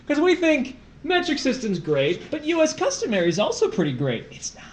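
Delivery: excited. Acted. Comic pitch for nerdish system.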